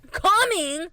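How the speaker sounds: high, loud call. Acted. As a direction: sing out the word loudly. Calls out mid-story.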